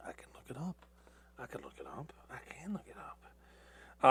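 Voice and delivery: muttered under breath while checking; whispered to self. Real, not acted. Checking past podcast date, whispering to himself. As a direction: whisper it to yourself as you look.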